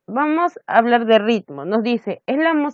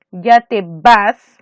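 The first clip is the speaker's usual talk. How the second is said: Ya te vas slow, vas hit louder; stressed syllable; 6 dB louder, much slower than usual. Shown as a demonstration. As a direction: stress vas firmly and slowly. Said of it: "es la tercera". (acento en vas, lento y fuerte)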